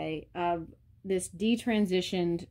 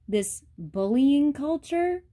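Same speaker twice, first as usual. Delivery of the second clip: higher, louder and slow; slow emphatic stress; 8 semitones higher, 5 dB louder, slower than usual. Real, not acted. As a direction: lean hard on each word of This bullying culture. Ranting about online bullying.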